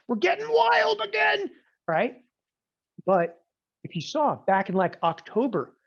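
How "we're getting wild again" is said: high, loud squeal of a hyped market; market voiced as a wild trader. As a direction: play the market as an overexcited trader for a laugh. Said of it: "the market was like".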